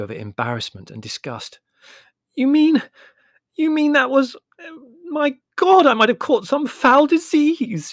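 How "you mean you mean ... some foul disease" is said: very high, loud, slow wail; panicked wailing over a foul disease. A narrator's reading. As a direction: wail it out in horror. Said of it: "she wailed".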